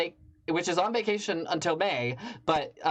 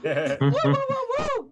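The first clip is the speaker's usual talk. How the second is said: rising whoops, last one much higher; crowd-hyping woo; 10 semitones higher, 5 dB louder than usual. Real, not acted. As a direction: whip up the room with cheers. Host hyping crowd for comic.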